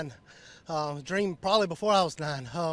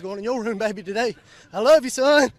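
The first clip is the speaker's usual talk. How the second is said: real joyful outburst, much louder at the end; emotional call to son; 3 semitones higher, 11 dB louder than usual. Real, not acted. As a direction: shout your love to your son at home. Winner calls out to his son.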